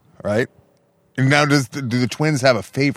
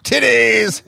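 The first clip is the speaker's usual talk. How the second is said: high, exclaimed soundbite imitation; Trump; 12 semitones higher than usual. Cutting in with a favorite soundbite, imitated high exclamation. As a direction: blurt it out high like the clip. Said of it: "every time they play the Trump".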